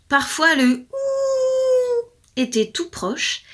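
slow, high howl stretched through the words; howling narration. A narrator's reading. Storyteller howling the line like the wolf. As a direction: let the howl bleed into the sentence.

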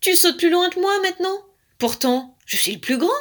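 high, loud indignant complaint; vexed. A narrator's reading. Romarin outjumped by his little brother, vexed. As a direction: complain like an outdone big brother. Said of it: "grommelait Romarin vexé".

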